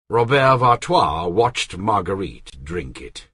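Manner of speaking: usual speaking voice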